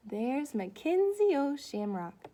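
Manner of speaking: normal talking voice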